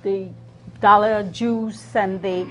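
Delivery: ordinary talk, nothing marked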